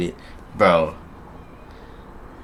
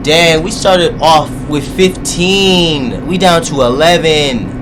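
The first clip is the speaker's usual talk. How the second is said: dismay; 7 semitones higher, 5 dB louder, much slower than usual. Real, not acted. Counting the dead classmates.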